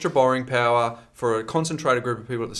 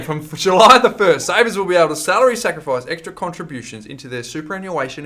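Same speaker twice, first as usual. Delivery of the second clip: louder, slower careful restart; 6 semitones higher, 9 dB louder than usual. Real, not acted. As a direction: start again, louder and slower. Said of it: "I'll start again".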